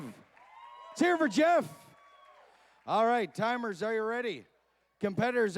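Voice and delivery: loud, high crowd-pumping hype; hype announcer. Acted. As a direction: rile up the crowd, bigger each call.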